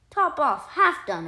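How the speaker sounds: puzzled. A narrator's reading. Mouse puzzled repeats names.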